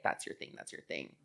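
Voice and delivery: near-whispered, low retold remark; hushed dismissal. Friend leaving the other to their thing, hushed dismissal. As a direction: back off softly, almost whispering.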